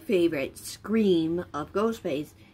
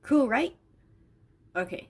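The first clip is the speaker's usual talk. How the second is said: normal talking voice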